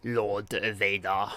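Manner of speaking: a faint sinister Emperor, drawn out; Emperor impression. Streamer retrying the Emperor voice, slow and low. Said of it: "Nah, I can't do it".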